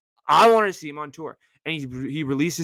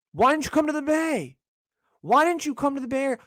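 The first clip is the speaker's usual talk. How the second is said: whiny plea; 8 semitones higher than usual. Real, not acted. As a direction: plead with Jack in mock despair.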